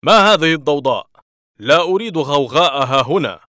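loud, commanding boss voice; stern manager roaring for order; firm shout. A narrator's reading. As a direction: silence the whole room at once. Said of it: "وصاح في حزم".

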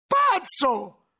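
a high, loud furious bark; angry shout. Acted. Cellarer shouts at the accusing monk, furious. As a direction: hurl the word at him. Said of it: "gli gridò ancora Remigio".

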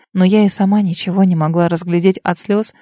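usual speaking voice

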